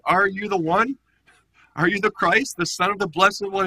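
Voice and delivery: high priest interrogating Jesus, loud; demanding high priest. Retold or imitated. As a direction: press him hard for an answer.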